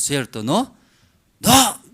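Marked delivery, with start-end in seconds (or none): 1.4-1.8 sudden high, very loud parental no; raised voice. Acted. Father stopping child's nagging for chocolate, explosive shout. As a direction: startle the child with one shouted word. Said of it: "Entonces, alza voz".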